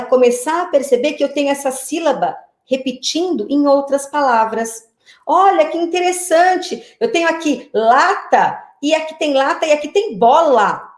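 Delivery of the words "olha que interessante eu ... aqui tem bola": delighted discovery. Acted. Teacher acting out a child spotting syllables in words, delighted discovery. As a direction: point out each find with acted wonder.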